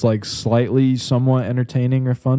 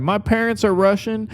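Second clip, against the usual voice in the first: raised pitch, held-back shout; retold yell; 9 semitones higher than usual. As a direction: imitate calling out from the crowd. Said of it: "I yelled out".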